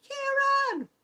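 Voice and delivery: high, playful pretend scold; mock viewer complaint. Host voicing a viewer who was missed, high playful complaint. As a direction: pretend to be a left-out viewer, teasing. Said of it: "come back and say".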